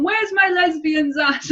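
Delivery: high, slow, loud shout; mock old lady shout. Old lady at the film's end calling for the lesbians, shouted. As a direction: call it out like a delighted old lady. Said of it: "old lady at the end of the film going".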